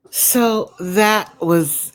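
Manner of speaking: ordinary delivery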